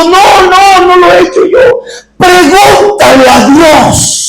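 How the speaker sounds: súplica aguda de niña, sin llanto real; súplica llorosa imitada. Retold or imitated. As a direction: imita a una niña suplicando entre lágrimas. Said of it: "Y la niña estaba llorando". (ruego lloroso, actuado)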